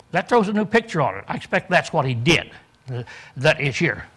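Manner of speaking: normal talking voice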